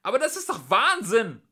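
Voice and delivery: high, loud protest; alarmed, full-voiced objection; crying out. A narrator's reading. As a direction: shout it, appalled. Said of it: "rief Trillian".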